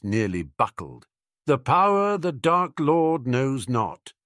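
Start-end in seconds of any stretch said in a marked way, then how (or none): 1.4-4.1 slow, high, loud pronouncement; prophecy. Acted. Prophecy recalled by the boy it concerns, solemnly intoned. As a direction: intone it slowly like an oracle. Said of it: "the prophecy said".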